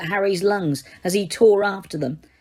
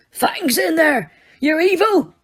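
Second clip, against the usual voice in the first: Hagrid's bellow; 8 semitones higher than usual. Acted. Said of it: "Hagrid bellowed".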